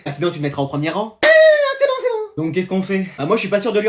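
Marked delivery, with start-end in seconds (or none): 1.2-2.4 very high, frantic objection; panicked protest. Acted. Pupil refusing the front row, panicked protest. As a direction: shoot up in pitch to object.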